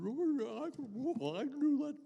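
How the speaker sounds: high, goofy cartoon dog; talking cartoon dog voice. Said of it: "this is a part of a dog that talks".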